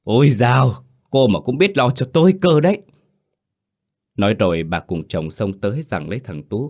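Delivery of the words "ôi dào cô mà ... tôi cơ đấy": higher and louder, through gritted teeth; mother-in-law sneers at Hà, high and loud; scornful snarl. A narrator's reading. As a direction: spit the sarcasm at the daughter-in-law. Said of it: "nghiến răng gằn giọng".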